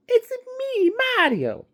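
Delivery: very high cartoon plumber squeak; squeaky high Italian plumber cheer; Mario voice. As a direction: pop it out like the game's Mario. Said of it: "does he sound like Mario?".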